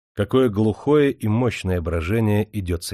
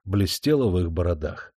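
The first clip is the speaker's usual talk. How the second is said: plain reading voice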